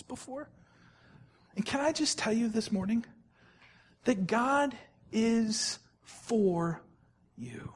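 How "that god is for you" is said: very slow, drawn out and softening; slow right down and let it land; tender reassurance. Acted. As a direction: pastor reassuring the church, slow and soft.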